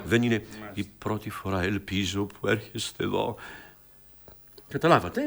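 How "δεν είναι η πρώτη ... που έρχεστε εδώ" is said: low, understated line reading; restrained demonstration. Shown as a demonstration. Director models the line for the actor, restrained. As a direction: keep it down, without exaggeration. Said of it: "να σας το παίξω εγώ".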